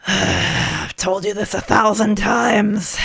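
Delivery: low, slow and tired; weary slow voice. Acted. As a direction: drag it out like a fed-up old man. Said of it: "So one of them, like".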